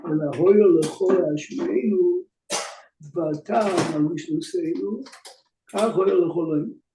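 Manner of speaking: fast, running Talmud study recitation; rapid text reading. Acted. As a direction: rattle off the Hebrew text quickly. Reads Gemara and Rashi aloud.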